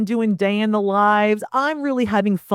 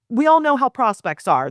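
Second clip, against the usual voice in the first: ordinary talk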